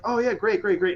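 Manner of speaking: high, easily impressed acted reaction; mock enthusiasm. Voicing a forgetful voter, mock enthusiasm. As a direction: gush as if readily won over.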